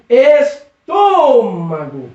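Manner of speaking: "estômago" very slowly, TÔ drawn right out; strong syllable TÔ. Shown as a demonstration. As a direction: hold the stressed TÔ of "estômago", letting mago fall away. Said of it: "é o tor". ("estômago" bem devagar, alongando o TÔ)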